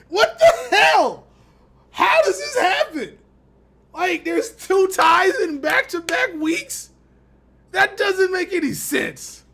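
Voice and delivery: slow, loud and high disbelieving rant; exasperated shout. Real, not acted. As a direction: drag out each word in outrage. Ranting about back-to-back ties.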